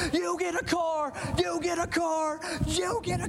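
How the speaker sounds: high, shouted Oprah-style announcement; Oprah giveaway. Retold or imitated. Oprah shouting to her studio audience, high and thrilled. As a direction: belt it out like a talk-show host giving away cars. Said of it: "she's going".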